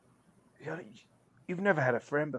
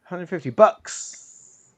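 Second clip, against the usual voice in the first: slow savoring echo; 2 semitones higher, 7 dB louder, much slower than usual. Real, not acted. Savoring echoed sum.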